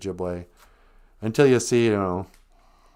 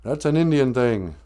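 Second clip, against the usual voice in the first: higher, louder scolding objection; indignant old activist; 5 semitones higher, 4 dB louder than usual. Acted. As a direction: call out a culture thief in a higher voice. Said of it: "some old aimer will see a hippie girl".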